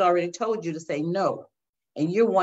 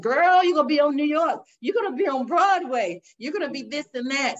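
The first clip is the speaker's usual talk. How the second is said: much higher, louder, coaxing temptress; tempting voice of the flesh; 8 semitones higher, 4 dB louder than usual. Retold or imitated. Her own flesh tempting her toward Broadway, coaxing and high. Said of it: "But that flesh said".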